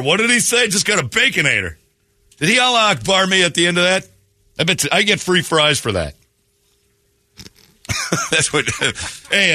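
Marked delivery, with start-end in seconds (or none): none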